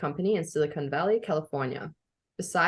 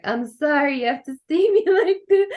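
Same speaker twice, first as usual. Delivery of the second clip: high, loud, half-laughing apology; a hammed-up sorry to the husband; mock sorry to husband; 9 semitones higher, 6 dB louder than usual. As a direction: ham up the sorry as she retells it. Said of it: "I started the day ugly crying".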